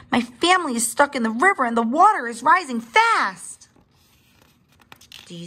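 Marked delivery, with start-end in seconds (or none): 0.1-3.7 high, urgent alarm; crying out. A narrator's reading. Littlest dinosaur begging rescue for his family, crying out. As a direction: plead urgently, voice pitched high. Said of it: "the littlest dinosaur cried".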